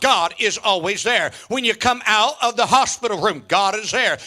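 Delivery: usual speaking voice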